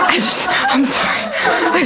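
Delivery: breathless laughing apology. Real, not acted. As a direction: say it through laughter.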